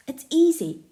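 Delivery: loud, high burst of excitement; lit-up excitement. Acted. Jake to his friends with a sudden idea, excited. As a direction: sell the brilliant idea. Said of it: "He suddenly lights up".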